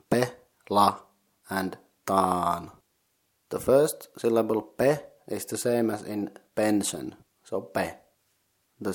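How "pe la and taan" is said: "pe, la, and taan" with pauses between syllables. Shown as a demonstration. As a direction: model "pe", "la", then a long "taan". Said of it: "consisting of three syllables".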